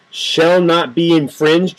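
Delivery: each word of shall not be infringed spaced out slowly; slow emphatic quote. Real, not acted. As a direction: spell it out word by word. Quoting the amendment slowly.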